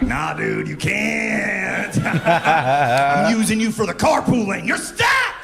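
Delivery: high shouted gloat; taunting yell. Retold or imitated. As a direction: yell it like a gleeful bully.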